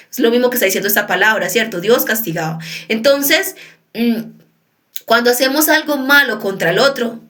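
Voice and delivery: usual speaking voice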